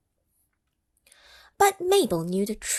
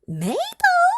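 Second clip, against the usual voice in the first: very high, louder cry of her name; high exasperated call; 7 dB louder than usual. Acted. A friend crying out to Mabel, high and louder.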